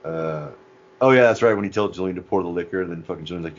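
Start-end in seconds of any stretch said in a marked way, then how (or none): none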